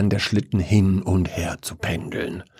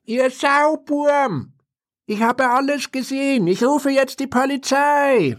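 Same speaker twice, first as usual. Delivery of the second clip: scolding from the window. A narrator's reading. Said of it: "schimpfte jemand aus dem Fenster". (sehr hohes, langsames Schimpfen)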